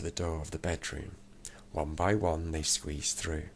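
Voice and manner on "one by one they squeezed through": ordinary delivery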